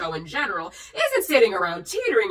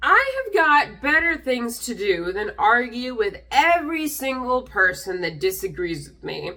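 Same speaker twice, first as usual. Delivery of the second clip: ordinary talk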